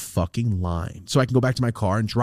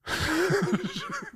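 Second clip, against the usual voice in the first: breathy high giggle; high laugh; 8 dB quieter than usual. Real, not acted. Friend cracking up at the clerk's yes, high laugh.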